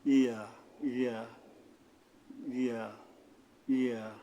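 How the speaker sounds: ইয়া said softly from mid-tongue; soft articulation. Shown as a demonstration. Teacher models the last letter, gently articulated ইয়া. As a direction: let ইয়া glide gently off the middle of the tongue. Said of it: "নরমভাবে আদায় হবে".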